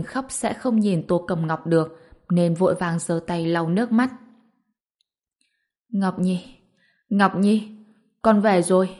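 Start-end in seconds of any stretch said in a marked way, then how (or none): 5.9-9.0 understated tearful emotion, building in strength; trembling, tearful. A narrator's reading. Mother greeting her returned daughter, trembling with emotion. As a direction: repeat the name with growing feeling. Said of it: "giọng nói run rẩy không che giấu được sự quan tâm".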